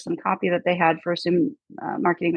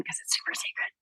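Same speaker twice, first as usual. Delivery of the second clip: hushed conspiratorial whisper; breathy secret-sharing hush; faster than usual. Retold or imitated.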